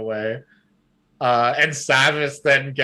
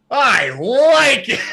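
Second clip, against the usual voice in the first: slow, loud, high booming delight; big man's approval; 10 semitones higher, 8 dB louder, slower than usual. Acted. Huge Costa to the small girl, loud slow delight. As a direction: boom it out with pleasure.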